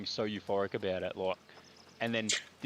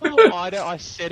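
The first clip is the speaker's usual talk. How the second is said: louder, higher, laughing; 8 semitones higher, 17 dB louder than usual. Real, not acted. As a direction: talk through laughter. Laughing while speaking.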